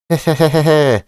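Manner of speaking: gleeful villain cackle; cartoon laugh.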